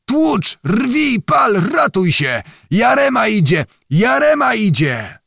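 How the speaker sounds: put-on old man egging peasants on to wreck the ferry; frantic barked commands. A narrator's reading. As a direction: hurl each command like a war cry. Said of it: "pan Zagłoba krzyczał".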